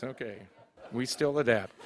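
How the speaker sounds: usual speaking voice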